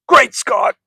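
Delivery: high, loud eccentric-scientist exclamation; Doc Brown impression. Likening the professor to Back to the Future, excited Doc Brown. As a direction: burst out like an excitable movie scientist. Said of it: "like an Australian accent I'm doing".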